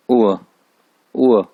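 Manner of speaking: उआ from the throat, falling; punched falling उआ with an ain sound; throaty ain sound. Shown as a demonstration. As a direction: push उआ out from the throat. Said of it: "हलक से निकलेगा आइन".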